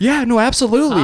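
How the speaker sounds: high, loud customer-pleasing voice; exaggerated eager agreement on the phone; fake agreeable agent. Agent humouring a caller before putting them on hold, high fake eagerness.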